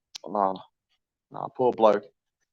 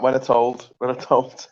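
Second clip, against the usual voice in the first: normal talking voice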